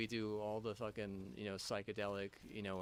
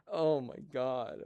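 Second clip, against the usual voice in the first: drawn-out exasperation; 3 semitones higher, 8 dB louder, much slower than usual. Real, not acted. Fed up with a nuisance.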